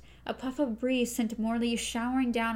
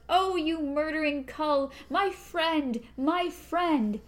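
wail of grief; 5 semitones higher, slower than usual. A narrator's reading. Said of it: "a wail of grief and rage".

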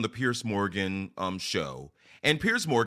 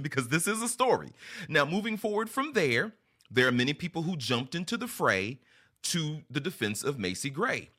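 ordinary talk

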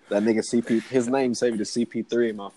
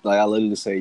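normal talking voice

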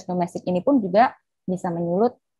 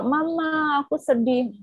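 put-on sad child; 7 semitones higher, slower than usual. Child telling mom sadness.

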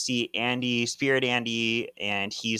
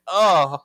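loud high oh of sudden cramps; pained groan; 7 semitones higher, 11 dB louder than usual. Retold or imitated. Prue doubled over with stomach cramps, loud pained oh. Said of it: "she's like".

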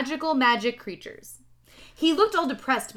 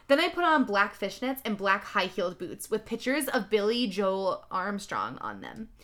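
plain reading voice

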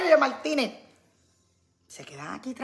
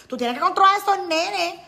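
bossy friend; 8 semitones higher, 9 dB louder than usual. Acted. Repeating the friend's scolding.

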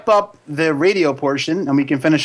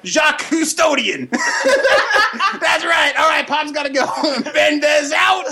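higher cartoon puppet voice, rowdy and laughing; Pops puppet voice; 10 semitones higher, slower than usual. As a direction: play a brash puppet roasting trick-or-treaters in a higher voice.

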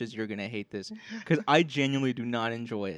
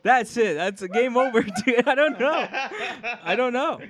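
breathless giggly laughter, pitched up and louder; laughing through words; 7 semitones higher, 4 dB louder than usual. Real, not acted. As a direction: laugh helplessly at your own flop. Laughing after botched rap.

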